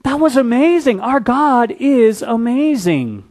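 high, loud delighted exclaim; acting out grateful disciples; enthusiastic agreement. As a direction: gush about how cool it was. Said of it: "they'd be like".

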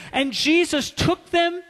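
normal talking voice

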